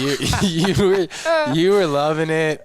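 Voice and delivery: laughing through the words. Real, not acted. Teasing the co-host about cracking up, laughing.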